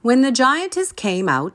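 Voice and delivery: usual speaking voice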